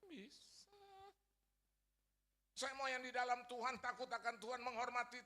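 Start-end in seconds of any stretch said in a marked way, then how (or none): 0.0-0.7 high, soft, tearful-sounding mom; mother's answer. Acted. Mother assuring her son God can, high soft voice. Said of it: "Ibu saya bilang".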